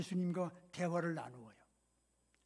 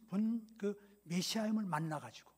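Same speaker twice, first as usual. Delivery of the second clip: ordinary talk, nothing marked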